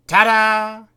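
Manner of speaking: big triumphant reveal; shouted. Acted.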